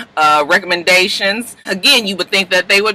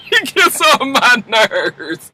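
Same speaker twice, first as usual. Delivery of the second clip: laughing; 7 semitones higher, much slower than usual. Real, not acted. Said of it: "I was just like".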